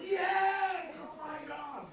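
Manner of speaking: high, shouted "Yes! Oh my God!"; delighted shout of recognition; excited yes. Retold or imitated. Answering Rita about the teacher, a high excited shout. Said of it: "I went".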